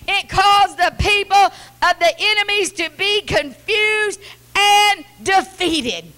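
high, loud, slow thundering preacher; preaching at full force. Real, not acted. Preacher proclaiming God's thunder, at full force. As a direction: thunder it out over the church.

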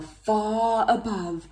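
usual speaking voice

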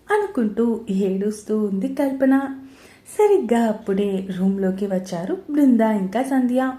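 ordinary delivery, nothing marked

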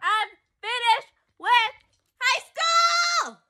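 high celebratory yell; excited shout. Real, not acted. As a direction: cheer it out high.

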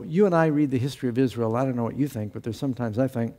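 normal talking voice

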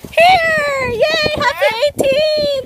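squealing with excitement; high, loud, thrilled; excited. Real, not acted. Excited reveal of snow figure.

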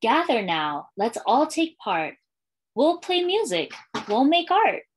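put-on lilting read-aloud for young kids, refrain given room; storytime reading. Librarian reading a welcome book to schoolchildren, storytime lilt. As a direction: read it like a picture book to a class.

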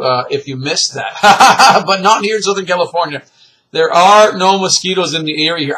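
normal talking voice

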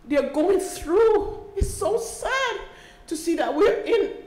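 high, drawn-out words through tears; tearful, emotional. Real, not acted. Mother telling meeting what families go through, tearful. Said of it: "I get so emotional".

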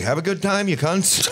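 a hint of a cheery Irish send-off; mock Irish. Imitating Irish relatives seeing the family off, lightly put-on. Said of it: "they were like".

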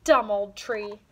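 loud, pouting complaint; sulky yell. Acted. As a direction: spit it out like a sulking child. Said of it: "yelled Emmy".

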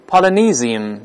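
"Polynesian" with a buzzed Z before the last syllable; Z pronunciation. Shown as a demonstration. Teacher offering the other pronunciation, "Polynesian" with a Z sound. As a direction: say "Polynesian" as polo-NEE-zin, carefully enunciated. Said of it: "发Z的声音也行".

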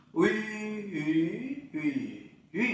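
"Uy" with rounded lips, through four Mandarin tones. Shown as a demonstration. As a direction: round the lips on each "uy", changing tone. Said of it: "Âm tròn môi". (đọc "uy" tròn môi, bốn thanh)

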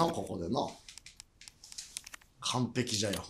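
ordinary talk, nothing marked

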